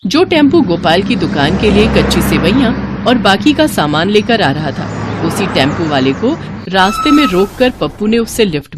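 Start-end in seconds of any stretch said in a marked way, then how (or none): none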